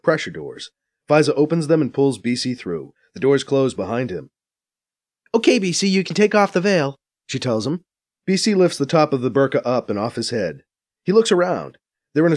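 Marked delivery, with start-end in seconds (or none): 5.3-7.0 brisk, higher female voice; young woman voice. Acted. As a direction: voice Faiza a bit higher. Faiza speaks, higher voice.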